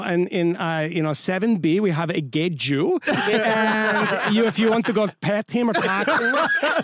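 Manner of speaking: mock Swedish flight attendant accent; exaggerated Nordic accent. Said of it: "I don't know what accent that is".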